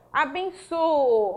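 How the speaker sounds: "abençoo" drawn out slowly, both o's held; slow example word. Shown as a demonstration. Teacher shows students "abençoo" slowly. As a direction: stretch "abençoo" so the double o is clear. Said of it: "Então, veja".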